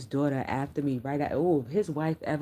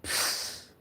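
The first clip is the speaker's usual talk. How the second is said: unvoiced scoffing puff; dismissive scoff; 5 dB quieter than usual. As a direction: blow air out in disbelief. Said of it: "and I said".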